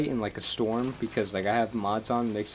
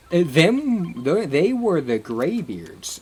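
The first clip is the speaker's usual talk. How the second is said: Jarl voice; 6 semitones higher, 5 dB louder, much slower than usual. Retold or imitated. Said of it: "the Jarl's like".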